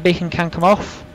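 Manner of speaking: normal talking voice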